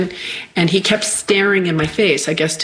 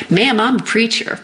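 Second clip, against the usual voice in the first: imitated Southern country preacher, higher; deep Southern accent; 4 semitones higher than usual. Country man introduces himself to injured woman, Southern accent. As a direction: play the Southern good old boy. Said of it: "like, deep Southern accent".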